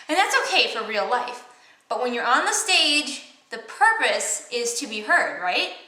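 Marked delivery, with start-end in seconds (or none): none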